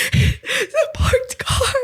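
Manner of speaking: mock bawled confession; blubbering. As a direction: wail it out like a sobbing kid. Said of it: "I just start bawling again".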